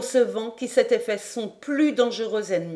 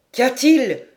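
loud, raised urgent cry; exclaimed question; 4 semitones higher, 8 dB louder than usual. A narrator's reading. Captain rushing to the bridge, crying out. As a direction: call out in alarm. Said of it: "s'écria John Mangles".

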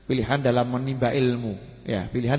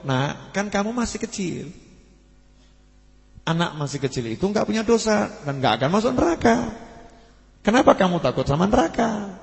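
put-on higher, coaxing elder asking the child; a pious elder gently reassuring a boy, a bit higher; kindly old man; 7 semitones higher than usual. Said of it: "Orang soleh tadi jawab". (orang tua yang lembut menenangkan anak, nada lebih tinggi)

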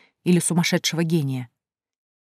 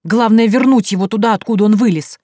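loud, raised-pitch angry demand; furious insistence; 4 semitones higher, 7 dB louder than usual. A narrator's reading. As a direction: insist forcefully, out of all patience. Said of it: "озверел Севастьян".

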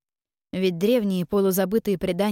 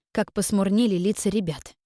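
plain reading voice

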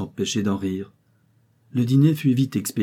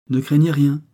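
smiling reassurance, higher and louder; with a smile; 7 semitones higher, 8 dB louder than usual. A narrator's reading. Geneviève reassuring her friend, smiling and confident. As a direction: brush off the worry with a smile. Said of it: "fit Geneviève avec un sourire".

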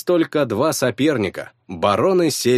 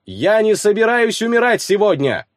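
shouting; 10 semitones higher than usual. Acted. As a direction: yell it across the arena. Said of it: "крикнул я, что было сил".

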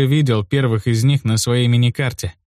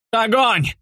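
high, strained battle yell; 10 semitones higher than usual. A narrator's reading. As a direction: bellow the order to fire. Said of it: "заорал я не своим голосом".

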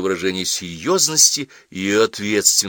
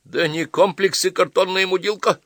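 a higher mocking mimicry of a friend; teasing imitation, higher pitch; imitating Sornyakov; 11 semitones higher, 4 dB louder than usual. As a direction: parody his friend's voice lightly. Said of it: "подражая Сорнякову".

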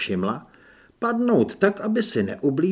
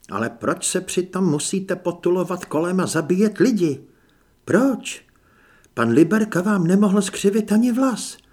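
raised, pleading reproach; indignant challenge; 5 semitones higher, 5 dB louder than usual. A narrator's reading. Anežka confronts the masked killer, indignant and raised. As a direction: confront them, voice lifting on the questions.

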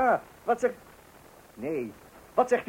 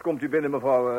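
ordinary talk

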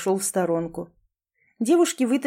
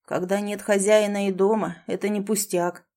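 plain reading voice

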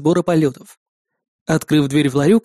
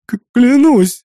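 high, loud tearful stammer; sobbing; 6 semitones higher, 5 dB louder, slower than usual. A narrator's reading. Terrified twin swears innocence, sobbing. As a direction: whimper out the oath. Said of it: "всхлипнул он".

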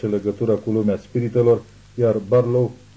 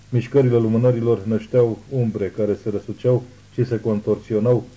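plain reading voice

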